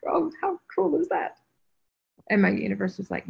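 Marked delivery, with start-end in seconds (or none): 0.0-1.4 high, thrilled mock delight; excited squeal. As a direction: squeal it like you just got great news. Excited retold reaction, laughing.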